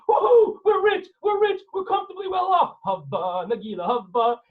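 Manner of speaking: high, loud, whooping celebration; overjoyed wife. Acted. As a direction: whoop and half-sing while dancing. Wife dancing over diamonds.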